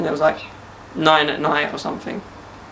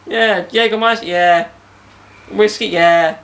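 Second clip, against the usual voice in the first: loud, hammed-up roll call of drinks; boozy party re-enactment; mock drunken enthusiasm; 3 semitones higher, 5 dB louder than usual. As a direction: cheer each one like an eager drinker. Re-enacts nightly lockdown drinking.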